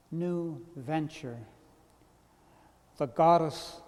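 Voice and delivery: poetry reading. Acted. Poet reciting to a listener about the closed ice plant, measured poetry reading. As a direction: read it line by line, each phrase falling into silence. Reads own poem aloud.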